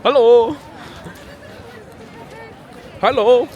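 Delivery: put-on loud, high German-accented hello; hammed-up German accent; German accent greeting. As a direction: call out hello like a German tourist. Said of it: "should put on an accent".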